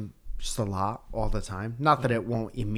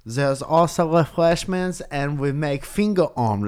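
a hammed-up German accent, louder, offering snacks; German curator continues; 5 semitones higher, 7 dB louder than usual. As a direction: keep up the snooty German curator.

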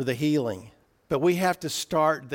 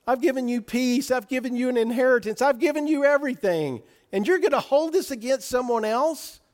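much higher, pleading rebuke as God; God rebuking; 9 semitones higher than usual. Acted. Preacher voicing God to the unforgiving servant, higher and aggrieved. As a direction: voice God's hurt disbelief at the ungrateful. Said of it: "what it looks like to God".

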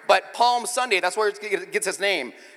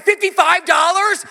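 high, loud slow disbelief; hammed-up shocked father; shocked dad; 11 semitones higher, 11 dB louder, slower than usual. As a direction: shriek the price in horror. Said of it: "I said".

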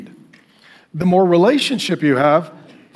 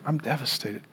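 low, nearly breathless sad line; devastated; 4 semitones lower, 12 dB quieter than usual. Acted. Voicing a broken heart to the congregation, hushed sad. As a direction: let it sink as if heartbroken.

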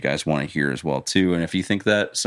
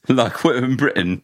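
louder, higher mock British accent; 6 semitones higher, 3 dB louder than usual. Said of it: "I get that you're doing the British accent".

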